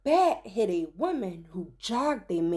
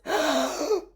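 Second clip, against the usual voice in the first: gasp; 4 semitones higher than usual. Acted. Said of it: "everyone gasped".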